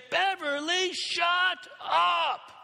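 high-pitched exasperated shout, re-enacted; yelling. Student turning on classmate before whole class, yelling. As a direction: yell it across a classroom, each word punched. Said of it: "I yell at her in front of the whole class".

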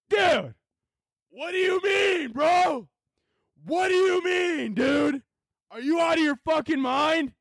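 outraged shouting at the screen; furious shout. Real, not acted. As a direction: scream it like you can't believe it. Rant at TikTok ban.

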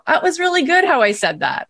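high, pleased amazement; surprised. Retold or imitated. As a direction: marvel at your own words. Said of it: "we say things that we go".

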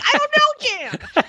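half-laughing retold exasperation; laughing disbelief. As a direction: laugh at the absurdity. Absurd advice from agent.

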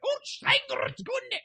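very high shrieking German ranting; Hitler impression. Shown as a demonstration. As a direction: screech it like a raging dictator. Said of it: "a Hitler impression".

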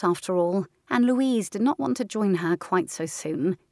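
ordinary delivery, nothing marked

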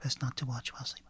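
whispering. Acted. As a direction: echo it under your breath. Player echoes hostess, hushed.

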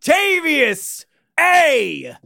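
hammed-up high, drawn-out introduction; very high announcement. Cohost grandly introducing his podcast partner, very high. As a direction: stretch the name out way up high. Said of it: "how high is he gonna go with this".

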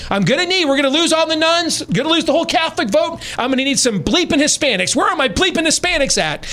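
hammed-up ranting boss losing his mind; retold screaming. Candidate yelling at his dumb campaign staff, mock high-pitched scream. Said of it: "he just keeps repeating this over and over again".

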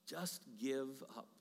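slow, soft, defeated inner voice; the quiet voice that says give up; discouraging inner voice. Pretend. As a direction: let it sink down like doubt. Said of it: "the voice I hear is just saying".

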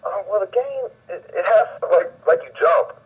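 hesitant, high mock customer voice; clueless customer. Acting out a clueless store customer.

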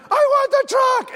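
high, loud mock tantrum; slow, high-pitched toddler shouting; imitated child yelling. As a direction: yell it like a demanding three-year-old. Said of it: "he's, like, yelling".

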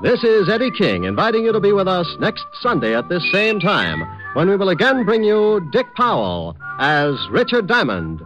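usual speaking voice

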